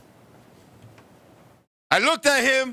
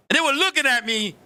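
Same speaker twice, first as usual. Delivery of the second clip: ordinary talk, nothing marked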